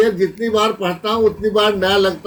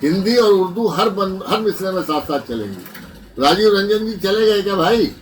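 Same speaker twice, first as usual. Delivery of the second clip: ordinary talk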